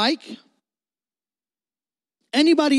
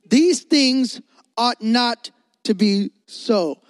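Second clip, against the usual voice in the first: these stressed, every word slow and drawn; slow solemn reading; 2 semitones higher, much slower than usual. Shown as a demonstration. Pastor reading the warning to the youth, slow and solemn. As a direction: let each word land slowly. Reads scripture slowly.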